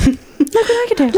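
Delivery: put-on high, quick childlike catchphrase; Stuart from Mad TV. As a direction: pitch it way up, playfully. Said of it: "Stuart from Mad T V".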